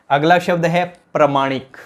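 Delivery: ordinary delivery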